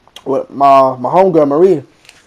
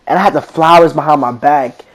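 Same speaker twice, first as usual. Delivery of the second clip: ordinary delivery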